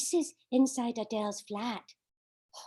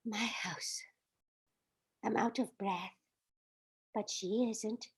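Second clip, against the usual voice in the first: breathless relieved whisper; whispering, just back inside; 4 semitones lower, 9 dB quieter than usual. Acted. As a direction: hush it out, safe at last. Safe inside after running.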